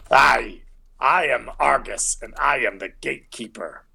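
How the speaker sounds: put-on stout dwarf's voice; dwarf gatekeeper. Dwarf gatekeeper introduces himself to visitors, in character voice. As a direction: announce yourself as keeper of the gate. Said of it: "And he just says".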